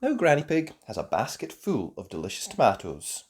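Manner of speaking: ordinary delivery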